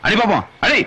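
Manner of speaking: high, escalating goad; heated dare. Acted. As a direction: dare them to strike, pushing harder.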